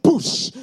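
loud imitated burst-through noise; explosive mock crashing entrance; Kool-Aid man crash. Bursting into the boss's office, mock crash noise. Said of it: "like I was the Kool-Aid man".

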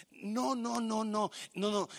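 usual speaking voice

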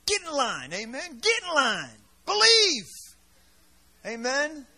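shouting fiery sermon to the crowd; rally the congregation, loud and rousing; preaching at full force. Real, not acted. Preacher rousing his congregation, fiery shout.

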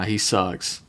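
ordinary delivery

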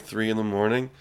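ordinary delivery

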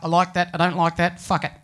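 loud blunt Aussie voice; blunt Australian. Retold or imitated. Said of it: "Australians are very direct".

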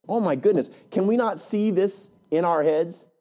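ordinary talk

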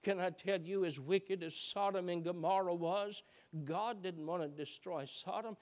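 ordinary delivery

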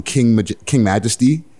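usual speaking voice

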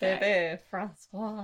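slow mock French accent. Shown as a demonstration. Helping a friend say it in a French accent. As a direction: draw the name out in French. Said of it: "Gotta say a little more French".

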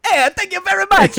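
put-on very high, loud Italian accent; cartoon Italian. Comedian answering host's intro, cartoon Italian accent. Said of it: "pigeonholed me into that, into that character".

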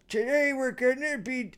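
high-pitched mock teacher voice; half-dead substitute. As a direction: imitate a barely alive substitute teacher. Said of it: "they're, like, half dead".

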